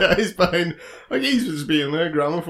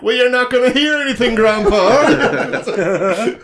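loud, higher mocking jibe; making fun; 6 semitones higher, 5 dB louder than usual. Shop staff to an old customer, making fun of him. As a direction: taunt him as a clueless old man. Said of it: "they're all making fun of him".

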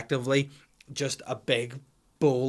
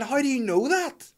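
incredulous; 13 semitones higher, 4 dB louder than usual. Retold or imitated. Dad questioning daughter.

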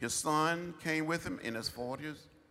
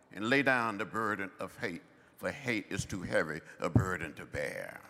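normal talking voice

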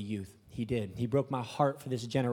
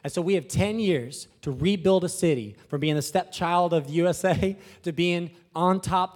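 ordinary delivery

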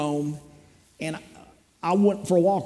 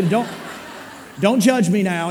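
laughing; 3 semitones higher than usual. Real, not acted. Laughing at own confession.

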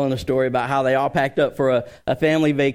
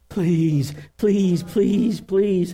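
higher-pitched imitation of a pleading daughter; hammed-up child's pleading; mock begging; 6 semitones higher, much slower than usual. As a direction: beg repeatedly like a kid putting on a show. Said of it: "she was putting on the show".

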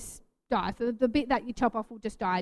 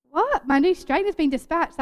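surprised; 5 semitones higher, 6 dB louder than usual. Retold or imitated. Reading surprising dispatch email.

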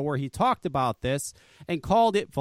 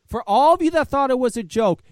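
"for all of you" again, louder and higher; louder repeat; 6 semitones higher, 6 dB louder than usual. Real, not acted. Repeating the line to viewers, louder and insistent. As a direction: hammer it home the second time.

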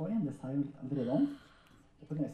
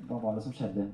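ordinary talk

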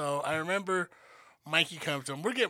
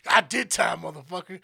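put-on loud defiant drunk; drunk brother talking back; 6 dB louder than usual. Drunk brother squaring up to his sister, loud and defiant. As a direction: bark it back like a tough drunk. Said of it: "he's just like".

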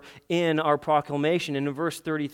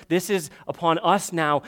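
ordinary delivery

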